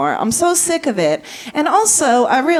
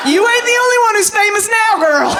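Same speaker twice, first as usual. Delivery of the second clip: proud dad shout; 11 semitones higher than usual. Retold or imitated. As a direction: crow it at her like a proud dad. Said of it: "he goes".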